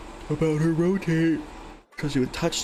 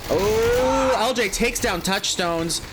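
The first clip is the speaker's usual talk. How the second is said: excited; 6 semitones higher, slower than usual. Real, not acted. Big knockdown in live cast.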